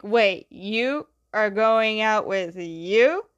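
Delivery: slow, drawn-out mock disbelief, rising at the end; acted surprise. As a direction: act out a stunned, incredulous question. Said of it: "Aang is all surprised".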